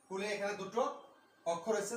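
usual speaking voice

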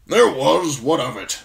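put-on loud, high, curt old officer; gruff old commander. Commander answers young man asking about a bird, loud and gruff. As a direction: brush the question off forcefully.

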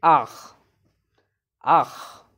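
ah with a rasping ch from the back of the throat; demonstrated ah. Shown as a demonstration. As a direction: breathe out ah, ending in a throaty ch. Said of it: "A C H sound is".

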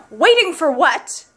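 high, slow, loud, demanding; loud rhetorical question. Acted. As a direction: fling the question out to the room. Rhetorical question at rant's peak.

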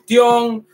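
"tion" sounded as Spanish "tión", T kept; wrong Spanish-style ending. Shown as a demonstration. Teacher shows learners the ending to avoid, mispronounced. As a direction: say "tion" letter by letter, stressed "-ón". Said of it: "no vamos a decir tion".

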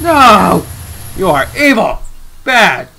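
loud, scolding yell; outraged shout. Real, not acted. Gamer yelling at a cruel villain, loud and scolding.

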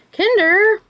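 curious high rising read. Real, not acted. Reads brand name off package.

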